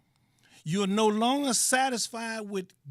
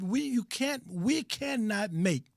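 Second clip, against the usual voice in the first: ordinary delivery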